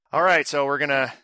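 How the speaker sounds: ordinary delivery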